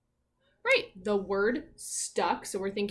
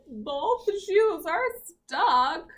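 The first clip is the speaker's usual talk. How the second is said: dramatic slow; 6 semitones higher, 5 dB louder, much slower than usual. Acted. Dramatic recap for children.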